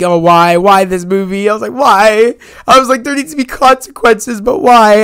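half-acted tearful "why" at the movie; retold anguished crying out. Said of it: "I was bawling".

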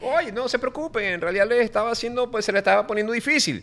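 joking traveler. Acted. Acts out the traveler in Spanish.

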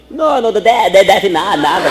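loud, high stuttering reply; stuttering friend. Acted. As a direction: stammer it out loudly. Amiga agora gagueja. (amiga gaguejando alto e agudo)

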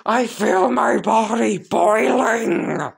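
high-pitched wail of a burning villain; pained yell. Acted. Sludge villain burning inside Deku, high agonized yell. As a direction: yelp in agony, dragging out the words. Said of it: "As the sludge villain would yell".

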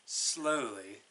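ordinary delivery

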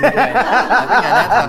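laughing through the words, slow; slow laughing complaint. Real, not acted. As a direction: laugh it out slowly.

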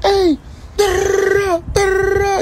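high squeaky panicking imitation; mock panicked voice. Mocking friend panicking in other room.

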